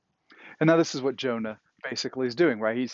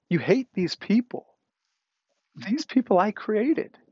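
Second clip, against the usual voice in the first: God pleading; 7 semitones higher than usual. Retold or imitated. Preacher voicing God rebuking Jonah over Nineveh, raised and pleading. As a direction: plead for the people, voice raised high. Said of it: "God says".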